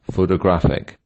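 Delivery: "photographic" with weight on GRAPH; pho-to-GRAPH-ic, "photographic" stressed on GRAPH; stress on third syllable. Shown as a demonstration. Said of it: "photographic on the third".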